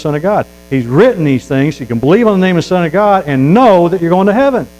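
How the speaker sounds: loud, rising hellfire preaching; full-force revival preacher; fiery preaching. Acted. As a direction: preach it hard at the congregation.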